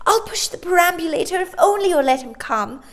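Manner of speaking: put-on high, loud coaxing girl's voice; pleading girl. Sister pleading with the nanny for her brother. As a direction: coax the nanny on his behalf.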